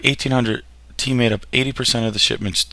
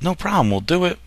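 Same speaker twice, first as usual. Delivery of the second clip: high-pitched, agreeable mock reply; lightly voiced Dutch traders; 8 semitones higher than usual. Dutch traders agreeing to the trade rules, lightly voiced and agreeable. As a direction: chirp it high like an obliging trader.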